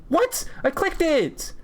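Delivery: very high, squealed disbelief; outraged disbelief. Real, not acted. Gamer marked wrong on New York, outraged disbelief. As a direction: cry out as if cheated.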